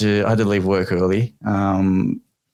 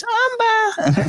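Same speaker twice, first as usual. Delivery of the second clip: put-on tiny, very high toddler squeak; squeaky little voice. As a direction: squeak it like a two-year-old. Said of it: "in a little squeaky voice".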